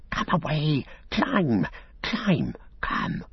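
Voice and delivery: high, frantic creature voice; hissing Gollum. A narrator's reading. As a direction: hiss it urgently in a high rasp. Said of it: "hissing behind his hand".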